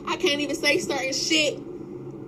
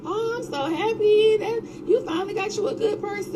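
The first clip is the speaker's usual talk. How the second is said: mocking gushing voice; 9 semitones higher, 3 dB louder than usual. Mocking people's praise.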